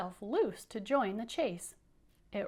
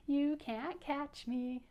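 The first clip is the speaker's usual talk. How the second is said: slow, higher sing-song tease; playful taunt; 4 semitones higher, slower than usual. A narrator's reading. Pickle thumbs its nose at pursuers, sing-song taunt. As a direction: taunt them in a drawn-out chant. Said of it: "great time being like".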